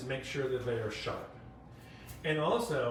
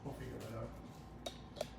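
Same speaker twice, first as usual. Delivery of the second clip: slow, much softer repeat; muttered to herself; 3 semitones lower, 14 dB quieter, slower than usual. Real, not acted. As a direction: say it half to yourself. Repeats it under her breath.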